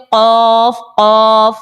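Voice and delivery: قاف held long, thick q at the back; heavy qaf. Shown as a demonstration. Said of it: "آپ ادا کیجئے".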